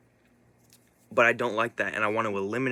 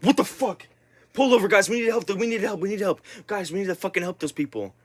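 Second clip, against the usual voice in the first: high, loud alarmed cry for help; urgent shout; 10 semitones higher, 4 dB louder than usual. Retold or imitated. Yelling at cousins to stop and help. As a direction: yell at them to pull over now. Urgent plea to pull over.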